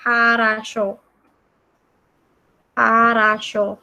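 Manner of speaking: "harasho" slowly, syllable by syllable; slow pronunciation demo. Shown as a demonstration. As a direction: stretch out "harasho" for learners. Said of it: "Ini bacanya".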